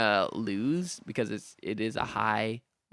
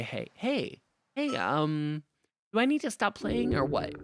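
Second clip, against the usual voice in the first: goblin voice; 9 semitones higher than usual. Acted. Voicing the goblin bard.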